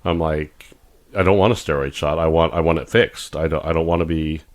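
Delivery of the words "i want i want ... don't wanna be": usual speaking voice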